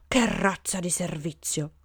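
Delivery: slow, drawn-out indignation; grumbled protest. A narrator's reading. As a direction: complain with disgust, stretching the words. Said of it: "le proteste diventarono un coro tempestoso".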